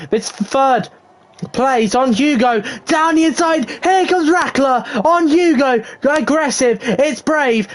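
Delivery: high-pitched commentary on a tense overtake; excited race play-by-play. Real, not acted. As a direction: call the move as it happens, excitedly.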